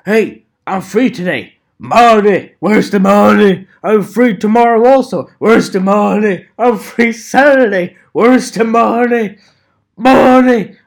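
loud, high, demanding where's the money; yelling to himself, desperate for paying work; money-hungry shouting. Retold or imitated. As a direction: shout for the cash like a greedy hustler. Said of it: "but I'm like".

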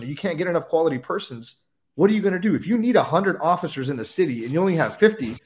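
usual speaking voice

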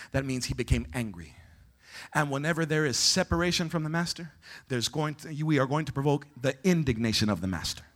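low, soft voice with weighty pauses; hushed solemn preaching. Real, not acted. Preacher explaining the master's indignation, low and hushed. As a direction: bring it right down and let each phrase settle.

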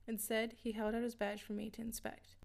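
ordinary delivery

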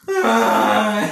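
loud, high comic scream; mock scream. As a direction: scream as if carried off, hammed-up. Acts out man taken by creature.

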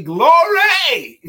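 high, loud burst of praise with a laugh; joyful shout. Real, not acted. Host cheering a listener's degree, loud and laughing. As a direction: shout it to the rafters.

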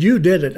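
loud, high "You did it"; emphatic credit. Retold or imitated. Student credits teacher for the cure, emphatic.

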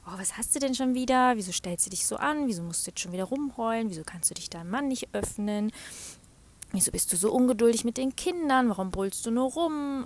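fast, loud nagging inner critic; self-reproach. Retold or imitated. Scolding herself for crying and snapping, loud and fast. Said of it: "bin nicht so streng und sag dann".

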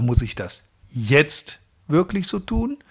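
jetzt set apart between short pauses; stress on jetzt. Shown as a demonstration. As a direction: hold back, then land on jetzt. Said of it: "ist eine andere Aussage als".

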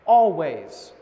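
"Always" said slow, low and loud; stressed "Always". Shown as a demonstration. Preacher emphasising Paul's word, loud "Always". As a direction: land hard on "Always". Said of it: "another word right after that".

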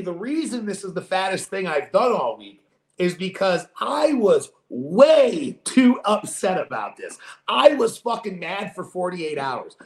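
normal talking voice

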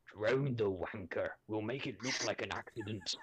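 put-on menacing Highlander accent, leaning in; deep Scottish accent. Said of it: "say with a deep Scottish accent".

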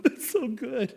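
soft breathy laugh while saying it; secretly touched, laughing it off; laughing softly while secretly moved. Retold or imitated. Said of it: "deep down I'm going".

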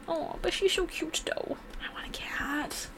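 breathy whisper over a cute cat; soft cooing. Real, not acted. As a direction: coo softly, longing.

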